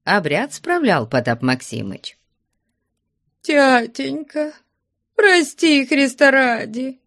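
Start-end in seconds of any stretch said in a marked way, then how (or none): 3.4-7.0 high, drawn-out wail; tearful pleading. A narrator's reading. Daughter begging father to forgive her, tearful plea. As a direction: beg in a high, keening voice. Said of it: "со слезами молила Параша".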